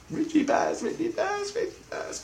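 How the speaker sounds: high, soft excited cheer; kids cheering. Retold or imitated. Kids cheering that Richie passed, high and hushed. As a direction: echo the kids' cheering.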